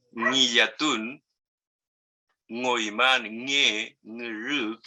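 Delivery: "ngiyatun, ngoiman, nge, ngru" with a nasal ng onset; nasal ng sound. Shown as a demonstration. Teacher demonstrates the ng consonant in words. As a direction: start each word on a nasal ng. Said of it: "es nasal".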